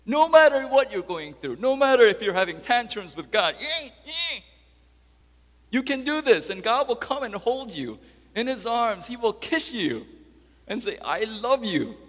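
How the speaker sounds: loud, high, fervent assurance; full-force preaching. Acted. Preacher promising God's embrace, fervent full-force preaching. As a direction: preach comfort with full force, easing slightly.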